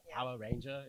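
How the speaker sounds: soft Aussie "Power Ranger", both words ending in "ah"; Aussie-Kiwi accent. Retold or imitated. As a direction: imitate Australians saying "Pow-ah Rain-jah". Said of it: "listen to the Aussies".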